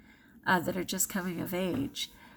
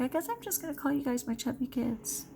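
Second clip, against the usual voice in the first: lightly voiced grandma, higher and a little soft; grandmother quote; 5 semitones higher, 4 dB quieter than usual. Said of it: "And she was like".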